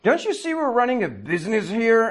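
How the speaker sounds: high-pitched indignant scolding; mock angry yell. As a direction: imitate an upset woman yelling, pitch way up. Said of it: "she starts yelling at me".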